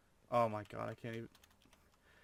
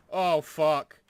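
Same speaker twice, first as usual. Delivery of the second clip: much louder, higher shocked cry; startled yell; 5 semitones higher, 14 dB louder than usual. Real, not acted. Player hit by sudden glitch, startled loud yell. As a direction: jolt of panic as you nearly die.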